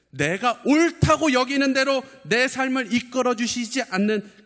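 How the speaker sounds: high, loud preaching cry; impassioned preaching. Real, not acted. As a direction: preach it at full pitch, pressing every phrase. Preacher at full force.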